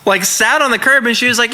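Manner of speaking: ordinary delivery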